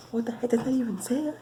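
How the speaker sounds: high-pitched, baffled outcry; shocked disbelief. Acted. Friend reacts to slime suddenly changed, high and shocked. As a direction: let the pitch leap up in disbelief.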